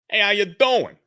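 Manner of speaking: loud, high Brooklyn guy; hey how you doing, Brooklyn style; a cheerful street-corner New York greeting; Brooklyn accent. Acted. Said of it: "Josh's voice is gonna be, he's from Brooklyn".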